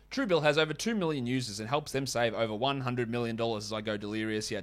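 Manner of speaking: ordinary delivery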